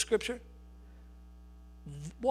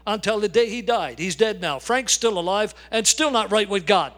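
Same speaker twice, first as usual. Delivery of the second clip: full-force preaching; 4 dB louder than usual. Acted. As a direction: hammer the story home to the congregation. Preacher builds to climax.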